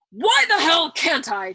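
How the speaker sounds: slow, high, loud bellow. A narrator's reading. General bellowing at the colonel, slow and loud. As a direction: bellow the question. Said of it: "General Dreedle bellowed".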